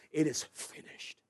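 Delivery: breathy, whispered last words; a whispered, voiceless cry; hushed cry. Acted. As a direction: give it as a dying breath. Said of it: "Und Jesus ruft aus".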